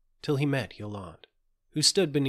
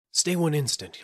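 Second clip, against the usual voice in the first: raised, higher call to wait; urgent halt; 6 semitones higher, 6 dB louder than usual. A narrator's reading. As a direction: stop them with a quick outcry. Gervais holds Yolande back.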